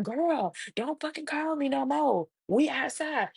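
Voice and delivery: imitating a fed-up mother, pitched high; exasperated mom. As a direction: tell the daughter off in a high, exasperated voice.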